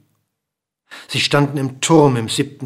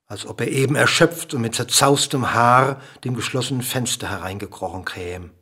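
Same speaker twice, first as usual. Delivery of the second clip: ordinary delivery, nothing marked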